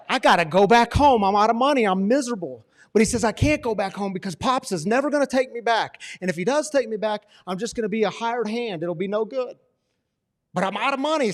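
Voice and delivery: high, loud pleading son's voice; desperate younger son. Acted. Prodigal son frets to himself, loud and desperate. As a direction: play the broke son talking himself home.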